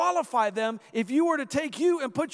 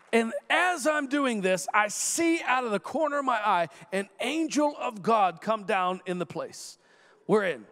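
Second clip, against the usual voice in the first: ordinary talk, nothing marked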